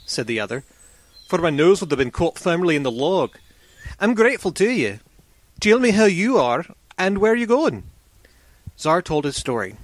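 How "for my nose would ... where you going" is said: a hint of a hearty woodsman, pitched up; lightly voiced grateful traveller, higher; grateful axe man. Freed axe man thanks his rescuer.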